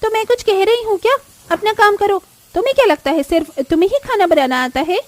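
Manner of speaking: high, loud, quick rebuke; angry snap. Acted. Swati snapping at the younger sister-in-law, high, loud and quick. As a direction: snap at her, sharp and high. Swati snaps at Payal in kitchen.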